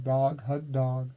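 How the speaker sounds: usual speaking voice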